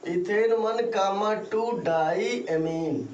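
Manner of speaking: Ethane one comma टू डाईएमीन spaced out word by word; slow dictation. Shown as a demonstration. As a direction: dictate it slowly for note-takers. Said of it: "अच्छे से note करेंगे आप". (शब्द-शब्द अलग करके धीमे बोलना)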